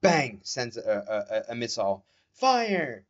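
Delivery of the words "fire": anime shout. Retold or imitated. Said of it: "sounds like she's in an anime".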